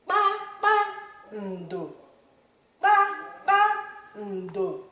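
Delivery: Kpakpando drawn out slowly and high; teacher modelling Kpakpando slowly; slow Igbo word. Shown as a demonstration. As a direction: sound out Kpakpando, each syllable separated. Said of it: "I'll repeat this one".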